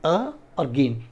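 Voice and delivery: अ and गेन said slowly as separate parts; syllable breakdown. Shown as a demonstration. Teacher showing where the word breaks, slow separate syllables. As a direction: set अ apart from गेन, slowly. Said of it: "break होगा".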